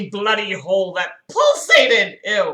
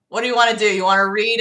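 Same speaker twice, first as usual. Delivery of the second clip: ordinary delivery, nothing marked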